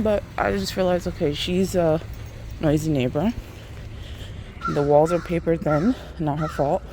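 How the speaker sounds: ordinary talk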